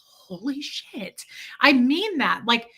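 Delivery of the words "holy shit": slow awed whisper; stunned whisper. Retold or imitated. Reacting to the shop owner's kindness, slow stunned whisper. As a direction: breathe it in disbelief.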